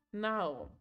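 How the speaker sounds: normal talking voice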